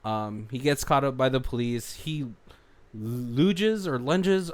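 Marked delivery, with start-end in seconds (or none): 2.9-4.5 "lunges or lunges", each word drawn out slowly, trying two ways to say it; unsure slow "lunges or lunges", testing both sounds; two pronunciations. Shown as a demonstration. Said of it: "I don't know what it's called".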